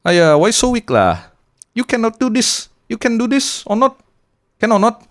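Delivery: shrill-free high mock nagging in Malaysian Chinese English; Uncle Roger Singlish impression. Podcaster roasting someone in a Singlish accent. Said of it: "gitu loh".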